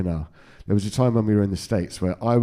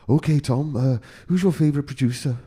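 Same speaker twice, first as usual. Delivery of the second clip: put-on Welsh accent; 5 semitones higher than usual. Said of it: "Is that a good Welsh accent?".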